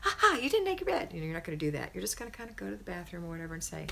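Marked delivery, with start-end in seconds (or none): 0.0-1.1 high, loud sing-song taunt; hammed-up "gotcha" jeer, pitched way up; mock gloating. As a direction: gloat like a teasing kid. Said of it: "you're not going to run in there and say".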